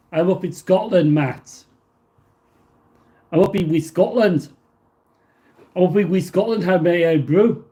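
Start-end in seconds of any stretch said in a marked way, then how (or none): 3.3-4.5 put-on Scottish lilt, pitch jumping up at the end; bad Scottish accent. Streamer telling a viewer where he is, bad Scottish accent. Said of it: "probably the worst Scottish accent ever". 5.8-7.7 hammed-up, badly faked Scottish accent. Streamer joking about being up in Scotland, bad Scottish accent. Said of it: "probably the worst Scottish accent ever".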